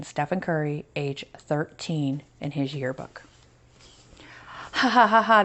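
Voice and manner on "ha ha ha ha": louder mocking ha-ha; mocking laughter. School kids mocking small Stephen, louder jeering laugh. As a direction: laugh at him like a schoolyard bully. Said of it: "the other kids at school laughed".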